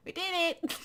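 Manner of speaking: high excited cheer; triumphant cheer. Real, not acted. Cheering after beating the snake, high. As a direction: cheer the victory. Celebrating a win.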